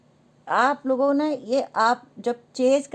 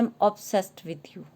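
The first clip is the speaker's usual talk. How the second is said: slow, hushed, drawn out; slow soft card reading; 2 semitones lower, 4 dB quieter, much slower than usual. Shown as a demonstration. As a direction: read the card like a confession. Reading tarot card message. (धीमी, नरम आवाज़ में पढ़ना)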